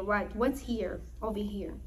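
usual speaking voice

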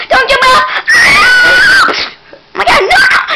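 very high, loud scream of no; real alarmed shriek; panicked shout. Real, not acted. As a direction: yell to stop the jump. Character about to jump.

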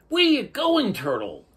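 high, loud calling voice; manta ray voice. A narrator's reading. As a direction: call out high. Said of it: "asked the manta rays".

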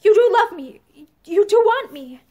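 high, loud, urgent pleading; desperate insistence. A narrator's reading. Woman begging her lover for reassurance, desperate insistence. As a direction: insist on it, hitting each sentence hard.

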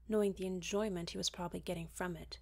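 ordinary delivery

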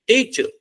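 first syllable of teacher pitched high, second falling; weight on the start of teacher, ending let go; stress on first syllable. Shown as a demonstration. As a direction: say teacher leaning on its opening syllable. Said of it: "जोर लगा के किसको बोल रहे हैं?".

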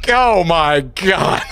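slow loud shout dissolving into a laugh; shocked shout. Real, not acted. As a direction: still reeling from the scare. After jump scare.

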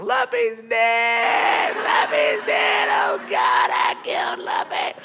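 loud, drawn-out distraught wail; hammed-up hysterical cry; screaming. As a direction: imitate someone screaming in guilt-stricken panic. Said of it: "[name]'s still screaming".

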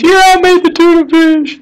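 a mild hint of a mother confessing; mothers answer. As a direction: chirp it like a guilty mother owning up. Mom voiced in story.

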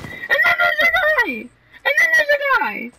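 high, slow, drawn-out shout; excited callout. Real, not acted. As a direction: yell out the warning. Spots enemy, shouts.

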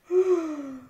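a sudden loud gasp. A narrator's reading. Story sound effect.

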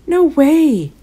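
high, loud refusal; yelled. A narrator's reading. Boy refusing the Holi trip, yelling. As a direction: shout it out in protest. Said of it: "yelled Dev".

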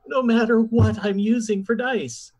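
high-pitched, exasperated gripe; exasperated complaint. Real, not acted. Player blaming cursed dice to the table, exasperated. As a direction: drag out the grievance, pitch climbing.

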